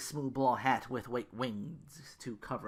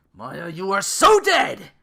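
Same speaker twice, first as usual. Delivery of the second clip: loud, high, slow furious scream; 9 semitones higher, 15 dB louder, slower than usual. Acted. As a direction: scream the threat at her. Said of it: "Ru screamed".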